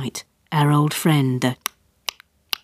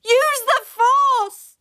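higher, very slow drawn-out yell; child yelling again, slower; long yell; much slower than usual. Acted. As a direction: stretch every word like a battle cry. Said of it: "I yelled".

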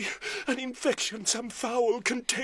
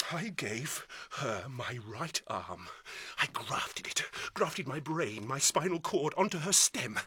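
a broken man's hushed confession; 11 semitones lower, 7 dB quieter than usual. Acted. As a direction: sink the voice almost to a whisper. Bitter confession about his daughter.

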